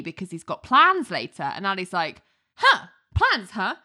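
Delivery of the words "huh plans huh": high, rapid-fire nosy questions; pry at him like a jealous detective; mock-suspicious interrogation; suspicious grilling. Ali grilling him about plans.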